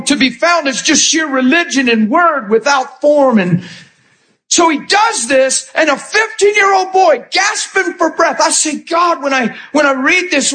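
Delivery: high, urgent preacher's pitch; full-force preaching. Acted. Preacher rousing the congregation over a martyred boy, impassioned. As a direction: preach it out with mounting intensity.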